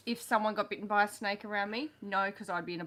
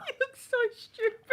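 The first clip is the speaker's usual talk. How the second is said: high squealing laugh through words; 14 semitones higher, 4 dB louder than usual. Real, not acted. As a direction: laugh out loud while speaking. Host laughs at pirate wig.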